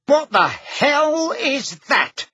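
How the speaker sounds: high, slow bellowing shout; bellowed. A narrator's reading. Uncle shouting at the filthy creature in his house. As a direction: bellow it in shock and outrage. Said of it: "Uncle Vernon bellowed".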